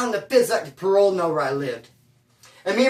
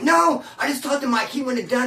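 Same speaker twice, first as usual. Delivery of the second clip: higher-pitched objection to an accusation; retold protest; 6 semitones higher than usual. Telling his brother Mike wouldn't have done it, protesting. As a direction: protest on a friend's behalf, mildly heated.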